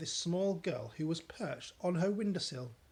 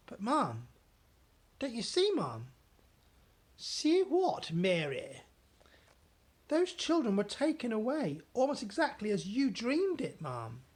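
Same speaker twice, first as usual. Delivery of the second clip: higher, earnest servant; maid voice; 7 semitones higher, 4 dB louder than usual. Acted. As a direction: press the point gently.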